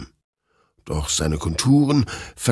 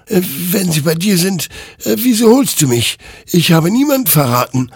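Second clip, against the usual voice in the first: high, loud, agitated denial; raised, pleading self-defence; defensive protest; 12 semitones higher, 6 dB louder than usual. A narrator's reading. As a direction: protest your innocence desperately. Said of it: "wehrte sich Hector".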